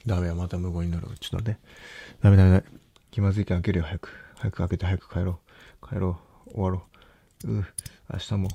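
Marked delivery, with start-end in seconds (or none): 2.2-2.6 loud panicked no. Real, not acted. Panicking before piercing.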